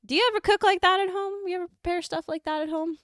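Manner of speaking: put-on high-pitched Southern old-lady voice; very Southern accent. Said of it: "this is in a very Southern accent".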